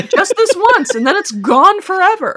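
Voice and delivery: high, loud, through laughter; laughing reassurance. Real, not acted. Host coaxes co-host to do it once, laughing. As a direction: tease them along while cracking up. Teasing co-host about their turn.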